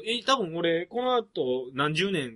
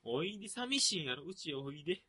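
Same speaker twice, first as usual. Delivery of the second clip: teasing; 4 semitones higher, 8 dB louder than usual. Acted. Teasing friend as lonely.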